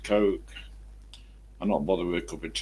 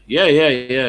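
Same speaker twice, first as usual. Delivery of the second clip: loud, raised Somerset brush-off; Somerset accent; 5 semitones higher, 9 dB louder than usual. Retold or imitated. Boss dismissing a correction, Somerset accent. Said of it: "the Somerset people say that".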